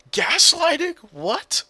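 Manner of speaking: loud, very high, drawn-out disbelief; incredulous outburst. Real, not acted. Reacting to teammate's gaslighting accusation, incredulous shriek. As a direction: shriek the word as a stunned question.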